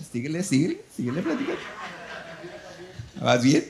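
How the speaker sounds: laughing, playful egging on; teasing laugh. Retold or imitated. Pastor laughingly teasing the taco owner to keep talking.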